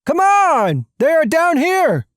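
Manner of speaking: high, urgent shout; pressing alarmed yell; calling out. Acted. Urgent call during jailbreak chase.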